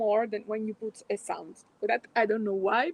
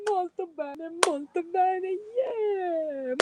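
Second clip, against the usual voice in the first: cheerful praise; 8 semitones higher, much slower than usual. Acted. Cheering after laughter exercise.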